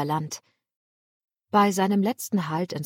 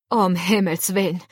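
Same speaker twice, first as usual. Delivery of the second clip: a sudden startled outburst, louder; surprised exclamation; 5 dB louder than usual. Acted. Captain hearing the stowaway is a woman, surprised. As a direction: blurt it out in surprise. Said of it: "rief der Captain überrascht".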